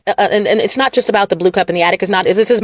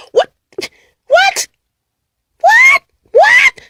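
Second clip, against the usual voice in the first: shrill rising what, louder each time; mock disbelief; 4 dB louder, much slower than usual. Mocking the idiots in shrill disbelief. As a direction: squeal it in outraged disbelief.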